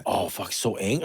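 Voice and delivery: ordinary talk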